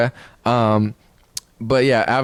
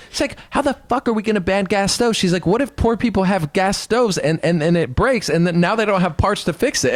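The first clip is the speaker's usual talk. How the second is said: high mock rant, freaking out; mock Anna freaking out at Cenk; Anna impression; 6 semitones higher than usual. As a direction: pitch it up and exasperated. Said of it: "I'm doing a Anna impression".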